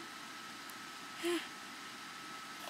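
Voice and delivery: soft breathy delighted gasp. Real, not acted. Watching the iPad wake up, delighted gasp. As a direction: gasp in wonder at the gadget.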